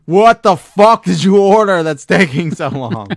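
loud exasperated parent yelling; retold angry yell. Parent yelling across the store at the sneaky kid, loud and annoyed. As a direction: bark at the kid like a fed-up parent.

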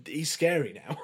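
real laughing exclamation; amused awe. Real, not acted. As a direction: laugh through the words in impressed disbelief. Joking awe at the actor's skills.

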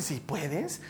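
soft, low, rising query; questioning echo. Acted. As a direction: echo it back quietly as a question. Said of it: "Y Jesús le dice". (eco en voz baja, como pregunta)

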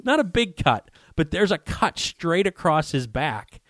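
high, excited with a laugh. Real, not acted. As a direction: still amazed, chuckling. Laughing excitedly about the cut.

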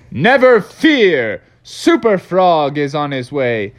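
ringing hero's battle cry; superhero call. Acted. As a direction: call out like a caped hero, slowly. Froggy proclaims himself a superhero.

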